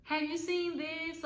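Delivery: high, singsong, sugary friendly greeting; sweet friendly ask. Retold or imitated. Asking the roommate nicely, a high singsong sweet voice. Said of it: "I kinda came to her like".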